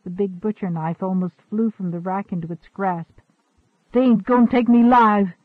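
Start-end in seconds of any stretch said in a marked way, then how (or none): 3.9-5.3 loud, high desperate yell. A narrator's reading. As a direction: shout it like a cornered girl. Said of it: "she yelled".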